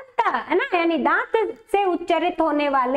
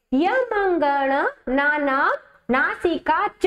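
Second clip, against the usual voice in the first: slow, drawn-out chant of the rule; sutra recitation; much slower than usual. Shown as a demonstration. Teacher to students reciting the sutra, slow chant.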